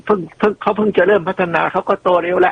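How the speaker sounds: mock the doubters in a high, sneering voice; mocking objector. Imitating listeners who object to praise of Vietnam, high mocking critic voice. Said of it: "ก็จะมีเสียงค้านตลอด".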